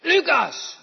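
loud high shout of the names. Shown as a demonstration. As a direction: call the children from far off. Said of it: "daar roep je niet".

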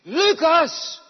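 loud high shout of the names. Shown as a demonstration. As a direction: call the children from far off. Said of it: "Als je roept".

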